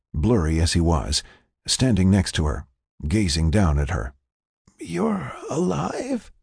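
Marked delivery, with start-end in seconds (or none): 4.8-6.3 slow, high, amazed question; frail disbelief. Acted. Patient learns friend survived, slow amazed voice. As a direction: draw it out in wonder, pitched up.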